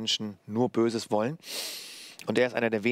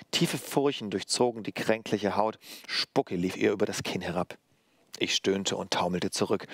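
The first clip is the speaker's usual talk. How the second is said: plain reading voice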